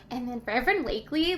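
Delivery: ordinary delivery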